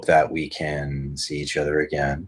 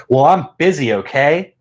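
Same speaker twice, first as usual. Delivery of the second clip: higher, louder snippy brush-off; mock snippy partner; 7 semitones higher, 7 dB louder than usual. Amazon voiced as a partner brushing off a complaint, snippy and loud. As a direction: snap back like an annoyed partner. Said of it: "you came back with".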